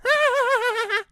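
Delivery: high, breathy giggle; joeys laugh. Acted. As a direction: laugh at him.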